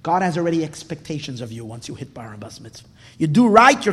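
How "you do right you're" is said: loud, punchy declaration; emphatic loud. Acted. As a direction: hit it hard and loud. Stark contrast of reward and punishment.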